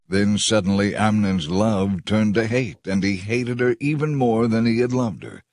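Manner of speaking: plain reading voice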